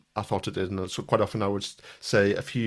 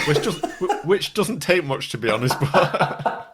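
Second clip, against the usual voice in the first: laughing through the words; giggly self-mockery; 8 semitones higher, 4 dB louder than usual. Real, not acted. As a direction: chuckle while admitting it. Laughing at own self-deprecation.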